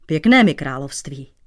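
ordinary delivery

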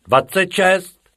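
loud, high, drawn-out count; pupil's roll-call shout. A narrator's reading. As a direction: yell out your number slowly. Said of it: "vykřikl druhý".

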